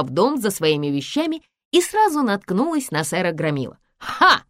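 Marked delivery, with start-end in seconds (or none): 4.0-4.4 high, sharp "ha!"; sudden high exclamation; exclaiming. A narrator's reading. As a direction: burst out with a triumphant ha. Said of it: "воскликнул он".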